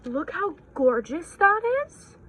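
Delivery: gushing. Real, not acted. Admiring the new book.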